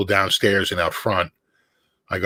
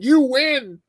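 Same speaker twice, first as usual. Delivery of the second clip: mock concession; 4 dB louder, much slower than usual. Friend conceding with mock awe.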